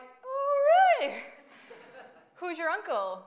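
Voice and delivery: a tiny laughing squeak of a question; squeaky. Retold or imitated. Asking the checkout guy about his uncle in a squeak. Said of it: "I squeak out".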